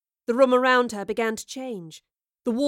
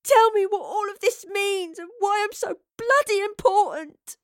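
high, loud, distraught outburst; sobbed; 11 semitones higher, 5 dB louder than usual. A narrator's reading. Betrayed Astrid demanding to know everything, sobbing. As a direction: demand it as if sobbing, voice pitched right up. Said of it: "she sobbed".

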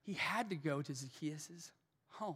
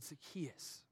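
tender call; 10 dB quieter than usual. Retold or imitated. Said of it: "Jesus said".

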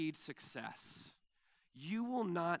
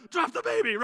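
panicked yell; 12 semitones higher, 11 dB louder than usual. Retold or imitated. Baby pooped on his leg.

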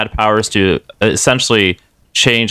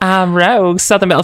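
put-on higher, sing-song Southern belle accent; Southern Rogue accent; 9 semitones higher than usual. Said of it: "I could do this, the Southern Rogue".

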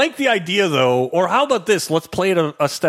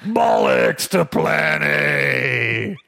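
long, drawn-out mock-Cockney shout; 4 semitones lower, much slower than usual. Co-host mocking the film's British cliches, hammed-up Cockney shout. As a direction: bellow it slowly in bad Cockney. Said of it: "like, British cliches of all time".

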